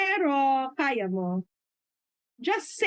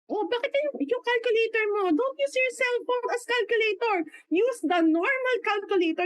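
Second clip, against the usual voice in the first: mataas na sermong pangaral ng mentor; ginagayang mentor; 9 semitones higher than usual. Retold or imitated. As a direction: mas mataas na tono, parang nagtuturo. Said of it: "sinabihan ako ni Mentor Daisy". (mentor na nagsesermon, mataas ang tono)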